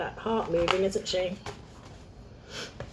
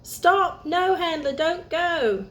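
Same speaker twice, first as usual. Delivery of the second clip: urgent shout to stop; 9 semitones higher, slower than usual. Acted. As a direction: yell before the car goes over. Car heading for cliff.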